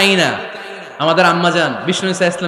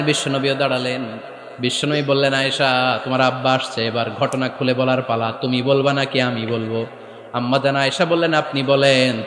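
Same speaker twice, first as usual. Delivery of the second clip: melodic sermon storytelling; 6 semitones lower than usual. Acted. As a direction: intone the tale, settling into a hushed low chant. Chanted telling of the story. (সুর করে ওয়াজের কাহিনি বলা)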